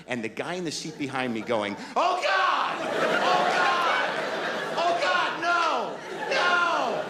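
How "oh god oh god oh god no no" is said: panicked screaming. Retold or imitated. Passenger behind him screaming in terror as the plane falls. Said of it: "He's freaking out".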